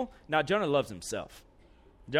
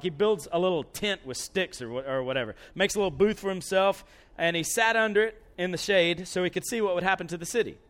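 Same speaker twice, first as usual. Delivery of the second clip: normal talking voice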